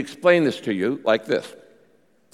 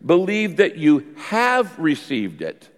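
slow, with "have" stretched out; measured reading that singles out "have"; stress on have; 2 semitones higher, slower than usual. Shown as a demonstration. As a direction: lean deliberately on "have". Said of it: "Notice the change in tense here".

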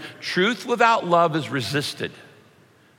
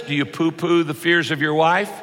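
ordinary delivery

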